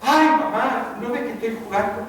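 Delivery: acted childish complaint; annoyed child. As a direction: protest to mother like an interrupted kid. Acted boy annoyed at his mother.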